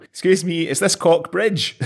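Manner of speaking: high-pitched polite inquiry; lost visitor. Acted. Lost visitor pulls up to ask a local, high-pitched inquiry.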